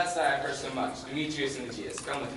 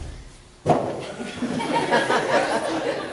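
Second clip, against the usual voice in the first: high mischievous laugh; 12 semitones higher, 9 dB louder than usual. Acted. Puck laugh.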